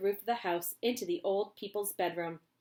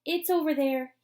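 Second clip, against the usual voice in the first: put-on eager boy; 7 semitones higher, 8 dB louder than usual. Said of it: "he said, pointing".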